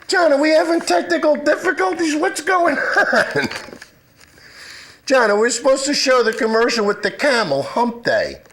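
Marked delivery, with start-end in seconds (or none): 0.0-6.3 flustered laughing. Real, not acted. Live broadcast falling apart.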